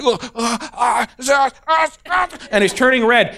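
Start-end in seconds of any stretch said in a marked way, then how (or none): 0.0-2.3 rising, higher strained stammer; little boy stuck on uh, climbing higher; straining kid. Retold or imitated. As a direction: stammer up the pitch like a flustered kid. Kid straining to answer, turning red.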